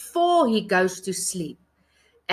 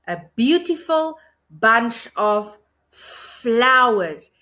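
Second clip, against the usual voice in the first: slow; slower than usual. Shown as a demonstration. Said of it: "what do you see?".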